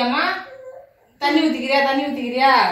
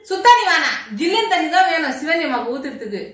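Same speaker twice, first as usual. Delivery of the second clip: ordinary talk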